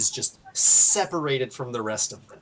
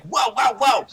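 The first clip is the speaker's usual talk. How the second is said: high, louder cry of alarm; startled alarm; 8 semitones higher, 6 dB louder than usual. Acted. Nero sees the spear thrown, startled alarm. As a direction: call out in alarm, pitched up. Game master voices startled onlooker.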